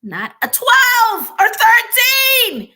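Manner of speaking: quoting the HR director, booming out the last words; mock shout. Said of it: "he's like".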